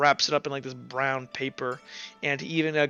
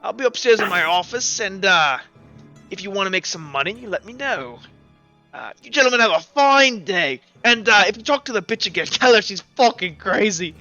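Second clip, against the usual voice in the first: higher, self-important character voice; brash job-giver character; 4 semitones higher, 6 dB louder than usual. Acted. Job-giver inviting them upstairs to his office, brash raised voice. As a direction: lay down terms, then sign off. GM plays Micah's parting offer.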